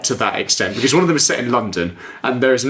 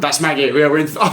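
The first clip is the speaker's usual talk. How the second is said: usual speaking voice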